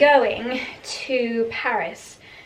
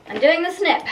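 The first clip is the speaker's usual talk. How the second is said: higher, louder repeat; raised, excited resolve; emphatic declaration; 7 semitones higher, 5 dB louder than usual. Real, not acted. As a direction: announce it boldly with a pitch jump. Repeats decision with mock drama.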